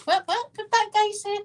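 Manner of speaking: high, puzzled mock voice of a stranger; confused bystander. People looking around, asking in a high puzzled voice. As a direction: play a baffled onlooker asking what was said.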